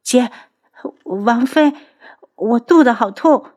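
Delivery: high, halting cry for help; pained cry. A narrator's reading. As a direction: gasp it out through belly pain. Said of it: "听到青姨低呼一声".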